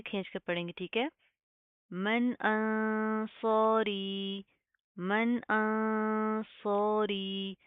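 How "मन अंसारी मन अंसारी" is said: अंसारी with a thick, full saad and alif; heavy saad. Shown as a demonstration. Teacher models the thick saad, chanting slowly. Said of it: "सौद के साथ का अलिफ को proper मोटा करना है".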